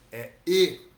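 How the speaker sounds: "é" aberto, depois "e" fechado, bem alto; vowel contrast demo. Shown as a demonstration. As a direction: contrast open é with closed e, loud. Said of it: "O sânscrito é não é é". (é aberto contra e fechado, enfático)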